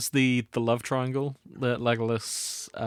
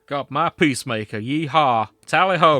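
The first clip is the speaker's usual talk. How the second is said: a hammed-up American cowboy accent, louder at the end; mock American cowboy; 8 dB louder than usual. Friend reading fanfic to mates, hamming a bad American cowboy accent. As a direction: play the American cowboy in a hammy Americanish accent, slipping British. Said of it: "that's the American one".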